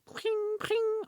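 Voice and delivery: very high pinging bullet ricochets; ping them out like bullets bouncing off; ricochet noises. Pretend. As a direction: mouth sound effect of ricochets. Bullets ricocheting, sound effect.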